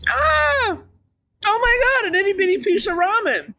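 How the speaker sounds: high, squealing delight; squeal of surprise. Real, not acted. Streamer spots a tiny ramen piece, squealing. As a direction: shriek at the little piece.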